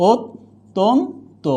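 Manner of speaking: অত্যন্ত slowly, "ot... ton... to"; syllable-by-syllable demo. Shown as a demonstration. Teacher showing the vowel change, slow syllable demo. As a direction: draw out অত্যন্ত with pauses between syllables. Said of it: "উকার হয়ে যাবে".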